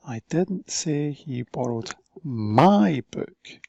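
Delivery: "my" leaned on, slow; slow, "my" emphasized; stress on my. Shown as a demonstration. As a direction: lean hard on "my". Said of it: "different emphasis on different words".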